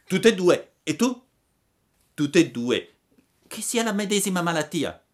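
put-on high-pitched storybook boys trading questions; lively high character voices; acted puppet boys' dialogue.

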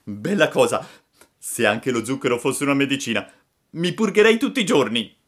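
put-on high, loud puppet boy; Pinocchio esuberante. A narrator's reading. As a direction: pipe up loudly like a cheeky puppet. (Pinocchio squillante ed esuberante)

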